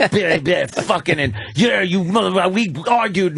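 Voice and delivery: hammed-up blubbering quarrel noises; mock passionate couple. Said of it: "could see it like this".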